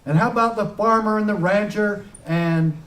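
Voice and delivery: impassioned stump-speech delivery; fiery rally speech. Acted. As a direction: rouse the crowd, hammer each line. Rally speech at full force.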